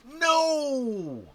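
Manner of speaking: very high, very loud wail of defeat; anguished no. Real, not acted. Player losing the roll, howling in dismay. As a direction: cry out as the dice betray you. Bad roll loses points.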